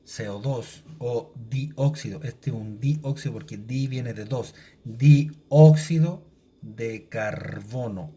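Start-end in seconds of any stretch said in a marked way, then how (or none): 4.8-8.1 slow reading aloud. Shown as a demonstration. Teacher to class, slow careful read-aloud. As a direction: read out slowly, word by word. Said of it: "Así se lee".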